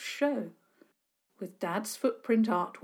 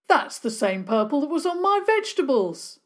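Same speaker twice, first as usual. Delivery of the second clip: loud, higher angry outburst; angry cry; 7 semitones higher, 7 dB louder than usual. Acted. Neighbour accusing Sarah Milburn, loud and angry. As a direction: cry it out in outrage. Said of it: "a man cried out angrily".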